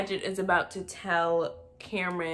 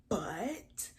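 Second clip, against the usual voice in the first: whispered insult; 10 dB quieter than usual. Real, not acted. Vlogger whispers an insult about Jordan. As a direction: whisper "butt" softly, unvoiced.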